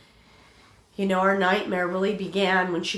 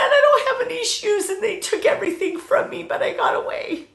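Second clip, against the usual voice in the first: much higher, tearful and shaken; 6 dB louder than usual. Retold or imitated. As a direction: speak through tears, high and thin. Daughter still crying on phone.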